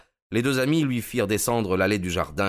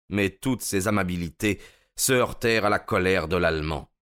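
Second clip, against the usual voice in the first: usual speaking voice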